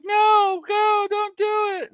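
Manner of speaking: high-pitched pretend panic; mock panicked plea. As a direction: plead in a put-on high voice. Acts out girl pleading for boat.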